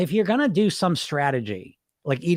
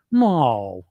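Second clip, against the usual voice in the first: ordinary talk, nothing marked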